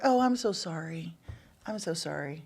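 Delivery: soft, hushed mock condolence; mock sympathy. Clinic worker consoling a patient, mock sympathetic. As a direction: trail down into near-silent pretend sympathy. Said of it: "That's infertility support".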